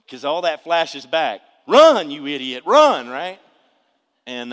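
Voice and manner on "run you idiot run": loud urgent yell, leaping high; yelled warning. Acted. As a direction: yell it like warning a movie character. Acts out urgent warning to himself.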